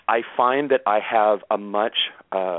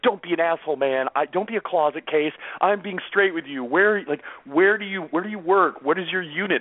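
higher, insistent tough-guy voice; angry firefighter fan; 7 semitones higher than usual. Retold or imitated. Fan insisting the writer is a fellow firefighter, pushy tough-guy voice. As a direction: demand it like a pushy fireman.

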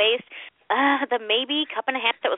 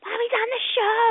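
exasperated mom; 9 semitones higher than usual. Retold or imitated. Mom answers pestering child.

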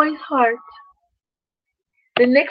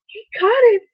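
higher, hushed wonder; amazed; 9 semitones higher, 3 dB louder than usual. A narrator's reading. Narrator marvels that Owen caught a snowflake, amazed. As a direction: say it like a small miracle.

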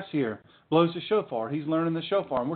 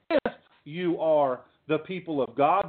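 "if" punched and set apart; emphatic "if" before the rest; stress on IF. Real, not acted. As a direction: lean hard on "if", then pause. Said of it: "I emphasize that word if".

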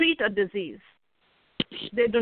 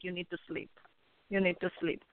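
soft insistence; 3 semitones lower, 10 dB quieter than usual. Real, not acted. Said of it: "repeats advice softly".

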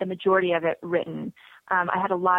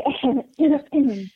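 embarrassed laugh; 7 semitones higher, 5 dB louder than usual. Real, not acted. Laugh after choking apology.

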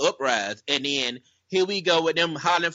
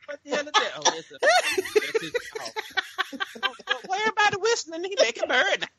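laughing; 14 semitones higher than usual. Real, not acted. Cracking up at bird call.

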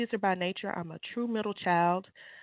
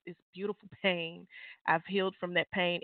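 ordinary delivery

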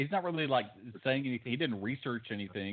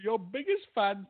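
high-pitched mock Indian accent; much higher, hammed-up Indian voice; 7 semitones higher than usual. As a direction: play a bot with an Indian accent. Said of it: "Indian voice though".

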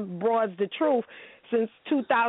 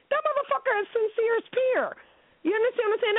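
heated point; 9 semitones higher than usual. Real, not acted. Heated rant about Sincere.

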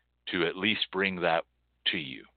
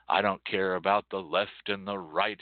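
ordinary talk, nothing marked

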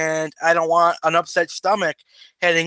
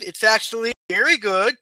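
ordinary talk

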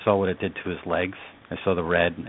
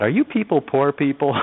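put-on higher-pitched, naive little-kid question; lightly voiced child's question; 8 semitones higher than usual. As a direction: lightly imitate your six-year-old self asking. Voices his six-year-old self asking.

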